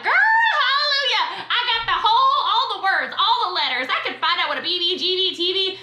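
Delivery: high, laughing, excited rave; mock overjoyed rule reader; gushing bookworm. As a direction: play the eager reader thrilled over the manual. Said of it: "You printed out your policies and procedures like".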